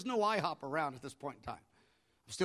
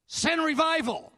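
high, loud and drawn-out plea; dramatic cry; 12 semitones higher, 8 dB louder, slower than usual. Shown as a demonstration. Preacher hamming up a bold plea to God. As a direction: belt it out like a revival preacher. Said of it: "I'm making up the fun part".

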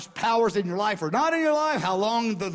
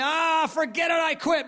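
fed-up shout; 9 semitones higher than usual. Retold or imitated. Believer in temptation giving up, shouting in frustration. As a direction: throw up your hands and yell quitting. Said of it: "everything in you wants to say".

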